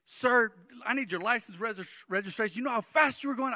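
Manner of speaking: officer barks at the speeding driver, angry and loud; angry cop. Retold or imitated. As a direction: play the angry officer demanding papers. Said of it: "The guy was walking up angry".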